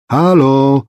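loud, high, searching hello; high, carrying hail; calling out. A narrator's reading. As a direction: holler to find whoever is home. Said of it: "powtarzała, rozglądając się".

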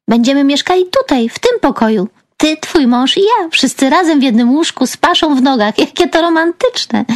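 high, gushing sarcasm; mocking. A narrator's reading. Girl mocking sister's marriage plans, high-pitched sarcasm.

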